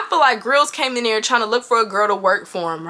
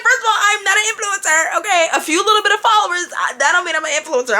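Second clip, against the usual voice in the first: flustered protest; 6 semitones higher, faster than usual. Retold or imitated. Narrator protesting, high voice.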